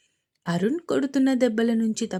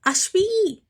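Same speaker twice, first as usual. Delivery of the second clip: much higher, loud calling cry; desperate shout; 10 semitones higher, 3 dB louder, much slower than usual. Acted. Advaith cries out for his missing wife, shouting. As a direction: call her name across the hall. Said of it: "గట్టిగా అరిచాడు అద్వైత్".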